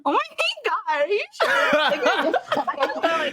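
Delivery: put-on high, bubbly Valley Girl voice, laughing; ditzy Valley Girl, way up high. Shown as a demonstration. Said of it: "let's hit the Valley Girl".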